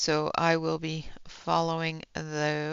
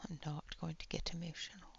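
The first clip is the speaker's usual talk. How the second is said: fast breathless whisper to herself; 17 dB quieter, faster than usual. Real, not acted. To stream chat, fighting tears, fast whisper. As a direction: whisper it quickly, holding back tears.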